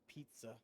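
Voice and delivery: "pizza" with a T-S sound, PEET-suh; clear Z's. Shown as a demonstration. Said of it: "it's the Z's".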